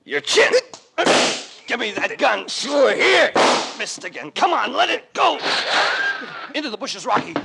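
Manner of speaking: straining shouts grappling for a pistol; fight shouts. Acted. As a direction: yell while wrestling for the gun. Fight over the gun.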